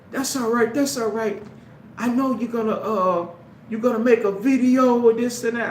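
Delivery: high, mocking sing-song of the hecklers; mocking taunt.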